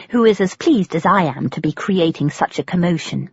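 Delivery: ordinary delivery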